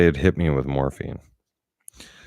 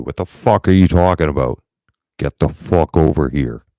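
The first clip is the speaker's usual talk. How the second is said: louder, angry shouting at the doctor; retold furious yell; 8 dB louder than usual. Patient yelling at the doctor who won't explain, furious. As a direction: blow up at her, demanding answers.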